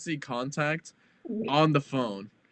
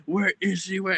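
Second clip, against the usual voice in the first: upset dad; 6 semitones higher than usual. Retold or imitated. Dad's voice, upset.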